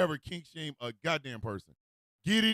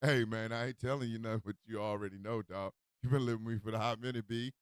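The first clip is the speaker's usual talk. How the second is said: low, soft, casual brush-off; unbothered roommate; 7 semitones lower, 6 dB quieter, faster than usual. Acted. Roommate Todd answering Mike's outrage, low and unbothered. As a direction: drop down low and shrug it off.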